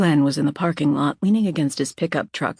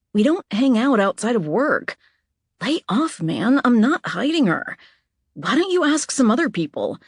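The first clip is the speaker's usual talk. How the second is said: defensive pushback; 7 semitones higher than usual. Acted. Anna telling Glenn to lay off, defensive and exasperated. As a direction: shove the accusation away.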